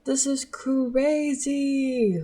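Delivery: high, drawn-out excited intro. Real, not acted. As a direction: stretch it out, giddy. Podcast intro sung out high.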